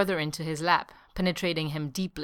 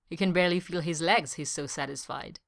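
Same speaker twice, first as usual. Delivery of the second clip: ordinary delivery, nothing marked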